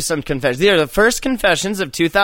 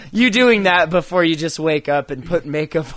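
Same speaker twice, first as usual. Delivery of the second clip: usual speaking voice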